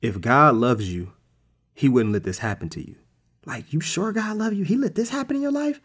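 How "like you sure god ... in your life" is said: very high-pitched doubting question; mock incredulous devil. Devil planting doubt about God's love, mock incredulous. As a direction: squeak out a needling, disbelieving question.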